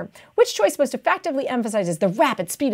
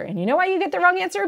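ordinary talk

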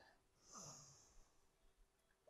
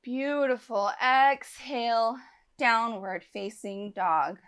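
normal talking voice

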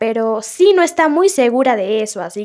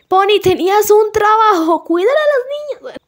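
exasperated scolding; 8 semitones higher than usual. Real, not acted. Scolding the game character.

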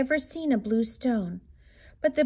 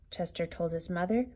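usual speaking voice

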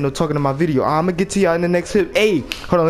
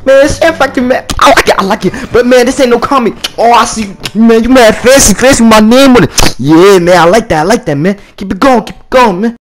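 loud, pumped-up hype-man chant, higher and louder; hype intro shout; 7 semitones higher, 11 dB louder than usual. Acted. Opening his video to the viewers, a loud hyped-up chant. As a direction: fire up the crowd with every man.

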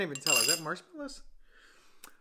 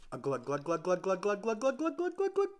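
rising glug sounds; 3 semitones higher, faster than usual. Real, not acted. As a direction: chant glugs rising in pitch. Pouring milk aloud.

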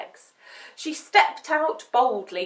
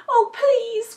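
high, loud puppy plea; distressed pup's cry; 12 semitones higher, 8 dB louder than usual. A narrator's reading. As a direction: beg desperately. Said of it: "cried Dumpling".

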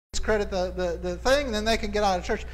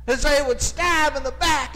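preacher's shout; 6 semitones higher, slower than usual. Retold or imitated. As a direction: drag out the pain in a booming shout. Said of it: "And he says".

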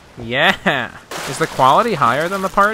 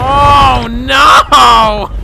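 high yelp of alarm; shocked shout; 9 semitones higher, 7 dB louder than usual. Real, not acted. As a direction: cry out as the ship hits. Ship crashing ashore.

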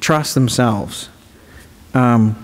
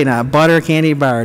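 normal talking voice

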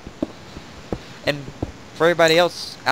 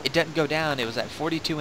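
ordinary talk, nothing marked

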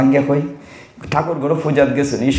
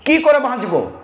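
higher, anxious pleading question; pleading Arjun; 8 semitones higher than usual. Retold or imitated. Arjun pleading to Krishna, higher, anxious. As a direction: plead like Arjun asking how to be saved. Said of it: "Arjun বলছে যে".

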